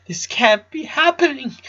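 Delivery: high, choked cry of a name; a grieving father sobbing out the boy's name. A narrator's reading. The husband cries out Lincoln's name in grief. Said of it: "Howard cried".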